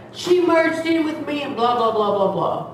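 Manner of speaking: high mimicry sliding down into blahs; mocking complaint. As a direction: mimic the accuser, then dismiss it. Mimics the other driver's complaint.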